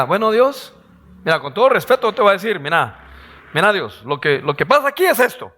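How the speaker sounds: mock someone lecturing God; disrespectful know-it-all. Preacher mimics a man telling God off, fast and cheeky. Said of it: "no faltará aquel que le diga".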